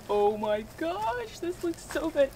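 high, delighted gasp over food; excited. Real, not acted. Food reveal.

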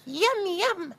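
tiny high chick voice; cheeping chick. Acted. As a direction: squeak it like a baby chick. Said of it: "cheeped little chick".

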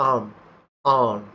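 আর্ম said as am, r silent; native pronunciation. Shown as a demonstration. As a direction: drop the r in আর্ম. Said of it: "আর্ম উচ্চারণ ব্রিটিশরা করে না".

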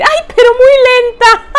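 high, loud mock tease; lightly voiced teasing friend; reading a friend's teasing comment. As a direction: tease her playfully.

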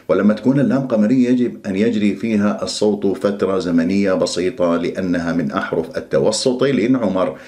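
usual speaking voice

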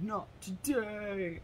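lightly voiced movie robot, slow; robot hero line. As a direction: repeat the robot's catchphrase slowly. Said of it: "and he's like".

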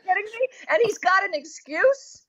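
high disbelieving cry; mock outrage at the excuse; incredulous. Real, not acted.

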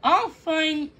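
high, insistent protest; arguing. A narrator's reading. The swallow argues with Thumbelina, insistent. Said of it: "Giacomo argued".

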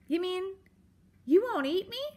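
high, tentative question; timid mouse. A narrator's reading. Mouse learning she is safe, timid disbelief. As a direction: ask hesitantly in a small high voice.

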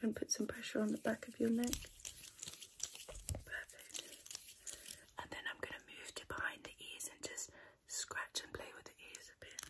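soft unvoiced ASMR whisper. ASMR whisper during massage.

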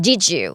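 "did you" run together as didja; linked didja. Shown as a demonstration. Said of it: "ก็เป็น did you".

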